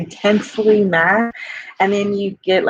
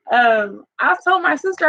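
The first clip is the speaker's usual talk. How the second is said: usual speaking voice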